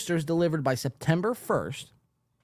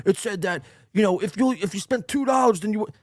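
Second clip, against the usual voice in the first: mocking Melton; 5 semitones higher, faster than usual. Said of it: "Patrick Melton's like".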